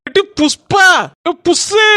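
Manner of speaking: loud high call across the street. Acted. Neighbour calling out to Pushpa, loud high call. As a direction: shout her name from afar.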